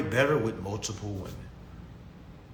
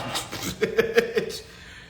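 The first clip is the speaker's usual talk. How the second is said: laugh; 9 semitones higher than usual. Real, not acted. Laughing at own remark.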